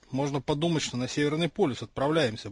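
ordinary delivery

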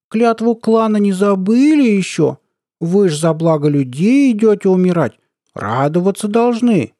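high, drawn-out mocking coo; squeaky baby talk. A narrator's reading. Colonel reminding men of clan oath, squeaky baby talk. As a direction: taunt in a piping, cutesy voice. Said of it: "сюсюкающим голоском вдруг пропищал".

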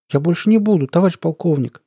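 high-pitched, meek apology; meek babble. A narrator's reading. Narrator feigning confusion before colonel, meek. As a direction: plead like a flustered subordinate. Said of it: "пролепетал я".

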